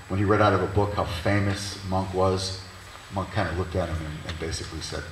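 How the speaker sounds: usual speaking voice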